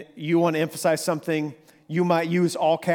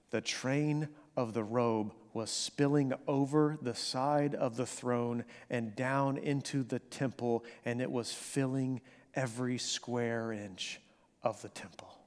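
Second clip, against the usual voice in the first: slowing and growing softer until it is a whisper; awed fading hush; 3 semitones lower, 9 dB quieter than usual. Acted. Preacher reading Isaiah's vision to the congregation, fading into a whisper. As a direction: let the scripture sink down to a hushed whisper.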